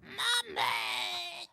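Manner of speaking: calling out for mummy; a baby creature wailing for its mother; baby creature calls its mummy, crying out; baby's cry. A narrator's reading. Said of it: "cried the baby creature".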